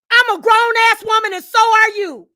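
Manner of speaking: high, loud indignant yell; loud, outraged assertion; angry shout. Real, not acted. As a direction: holler it indignantly. Furious self-assertion in rant.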